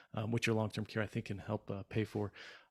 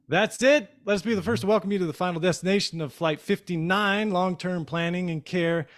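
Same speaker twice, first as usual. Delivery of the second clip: higher, louder announcer sign-off; upbeat outro; 4 semitones higher, 6 dB louder than usual. Acted. Host signing off to listeners, higher and louder announcer voice. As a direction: sell the closing lines like a flight announcement.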